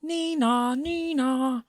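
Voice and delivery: very high sing-song siren; police siren. A narrator's reading. Police arrive for the thieves, a high siren. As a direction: imitate a police car siren. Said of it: "he called the cops, and they came".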